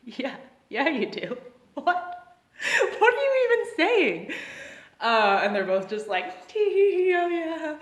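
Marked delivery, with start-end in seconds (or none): 0.0-4.3 higher, words breaking into laughter; barely keeping a straight face, then cracking up; holding back laughter. Retold or imitated. Concierge to the partying neighbour, laughing in disbelief. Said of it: "I'm trying not to laugh". 6.5-7.8 higher, louder giggled words; tee-hee like a giddy girl; mock girly giggle. Two girls beside the neighbour, giggling along.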